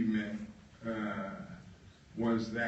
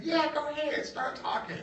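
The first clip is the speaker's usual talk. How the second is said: high-voiced boss. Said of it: "I said".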